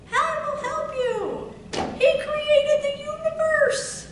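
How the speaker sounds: loud, high, slow preacher's cry; fiery pulpit reading; preaching at full force. Acted. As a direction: thunder the promise to the congregation.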